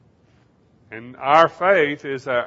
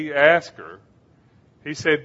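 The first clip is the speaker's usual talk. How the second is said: normal talking voice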